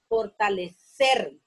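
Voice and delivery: fortalecer slowly, landing hard on CER; final-syllable stress. Shown as a demonstration. Teacher showing the aguda word, fortalecer stressed on CER. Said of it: "termina en ser".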